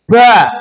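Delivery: "ba" loud, with a heavy puff after the b; BA with airy release. Shown as a demonstration. Teacher demonstrating the wrongly aired letter, puffed "ba". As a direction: burst air out on "ba". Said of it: "bunyinya gini".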